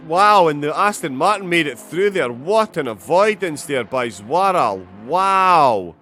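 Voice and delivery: loud, high gasping "oh" into excited praise; big loud wows, voice lifted; amazed race commentary. Real, not acted. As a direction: call a narrow escape in real astonishment. Reacts live to near-miss crash.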